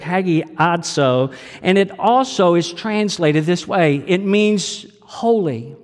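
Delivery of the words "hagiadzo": hagiadzo said slowly, syllable by syllable; Greek word. Shown as a demonstration. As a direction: sound out hagiadzo carefully. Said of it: "comes from a Greek word".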